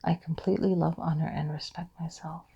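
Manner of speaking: quiet inward affirmation; whispered affirmation. Shown as a demonstration. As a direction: breathe it out as a whisper. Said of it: "notice the pause".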